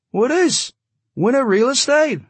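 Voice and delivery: put-on high, loud voice, light Yiddish accent; high-pitched big man, Yiddish-tinged; slight Yiddish accent. A narrator's reading. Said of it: "He had a slightly Yiddish accent".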